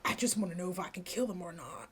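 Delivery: fast, soft and low; quick aside. Acted. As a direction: toss it off quickly under the breath. Quick repeat of the threat.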